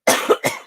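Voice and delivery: dry unvoiced cough; real dry cough. Real, not acted. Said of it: "My throat's getting dry".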